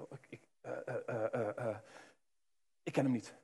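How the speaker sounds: soft, stammering, caught off guard; frightened stammer. Acted. Petrus caught out and asked if he knows Jesus, frightened soft stammer. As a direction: stumble over the words in fright. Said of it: "die schrikt en denkt".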